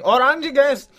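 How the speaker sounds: high, loud retold yell. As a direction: yell it out across the street. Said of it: "one of them was just yelling".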